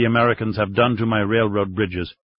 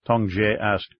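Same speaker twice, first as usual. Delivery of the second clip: usual speaking voice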